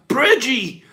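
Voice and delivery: high loud yell of the name. Real, not acted. Host hollering a viewer's name, loud happy yell. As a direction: shout it across the room. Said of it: "so I could yell the name out loud".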